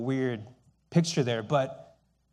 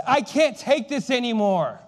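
raised, slow, fed-up cry; frustrated outburst; 9 semitones higher, 6 dB louder than usual. Retold or imitated. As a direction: Lincoln losing patience with his arguing cabinet, loud and slow. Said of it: "slams his fist. He says".

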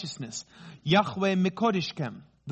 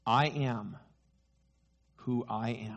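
soft, slow and grave with long pauses; hushed voice of God; solemn quiet; 6 semitones lower, 5 dB quieter, slower than usual. Acted. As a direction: let each word land in hushed awe. Quoting God's answer to Moses.

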